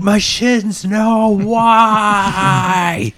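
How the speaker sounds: high, drawn-out theatrical wail; anguished mock cry. Game master as enemy fallen to his knees, wailing. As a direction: cry out in hammed-up agony.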